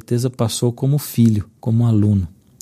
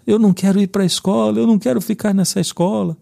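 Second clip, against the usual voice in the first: high whiny protest, pitched up; mild mock sob of a kid refusing; crying child; 9 semitones higher than usual. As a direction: imitate a little boy whimpering about school. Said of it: "E ele começou a chorar".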